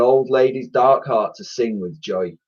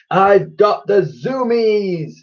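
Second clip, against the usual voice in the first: slow, high, loud whoop of joy; gleeful drawn-out boyish howl; delighted howl; 10 semitones higher, 5 dB louder, much slower than usual. A narrator's reading. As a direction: howl it out while rocketing skyward. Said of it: "howled the boy in delight".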